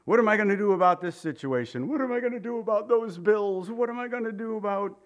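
high-pitched worried complaint; mock fretting. Imitates people fretting over bills.

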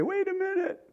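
a sudden high, squeaky protest; high mock protest at the start, then back to normal; mock objection. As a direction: play the indignant churchgoer. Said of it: "We might say".

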